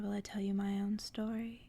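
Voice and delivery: ordinary delivery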